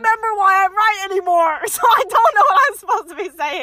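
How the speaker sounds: real laughter breaking into the line; laughing. Real, not acted. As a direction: laugh while admitting you forgot. Laughs at forgetting her argument.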